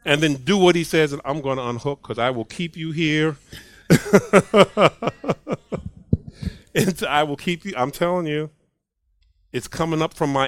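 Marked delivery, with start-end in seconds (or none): 3.9-5.9 laugh. Real, not acted. Laughs about keeping them long.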